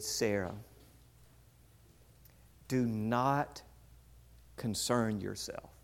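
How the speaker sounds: soft, measured calming voice with long pauses; calming reassurance. Retold or imitated. Pastor to worked-up bride, calming. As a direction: talk someone down softly.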